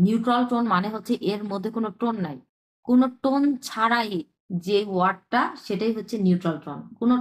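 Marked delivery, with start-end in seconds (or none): none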